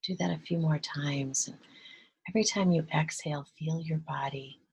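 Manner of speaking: soft, hushed meditation voice with long pauses; guided meditation. Real, not acted. Guide leading listeners to relax and breathe, hushed meditation voice.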